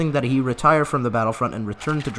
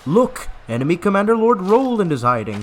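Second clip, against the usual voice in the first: higher, louder soldier's call; mock shout; 7 semitones higher, 5 dB louder than usual. Soldier pointing out the enemy commander, mock shout. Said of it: "someone suddenly shouts".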